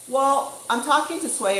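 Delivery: ordinary talk, nothing marked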